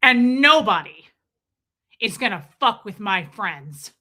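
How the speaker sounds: loud, menacing tough-guy voice; mimicking an ex-husband acting big and scary; big scary threat. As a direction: puff yourself up and threaten. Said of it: "really big and strong and scary when he goes".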